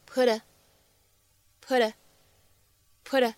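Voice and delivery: "put a" run together, the t flapped; linked flap T. Shown as a demonstration. Teacher showing students American flap T in "put a". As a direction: say "put a" almost like "pud-uh". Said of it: "连读的处理，flap T".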